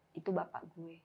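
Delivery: soft, subdued confession; retold quiet revelation. Friend reveals who the dream man is, hushed. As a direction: let it drop softly, imitating the friend.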